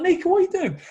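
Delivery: high, loud, laughing through it; laughing disbelief. Real, not acted. Host asking his friend what he's doing, laughing.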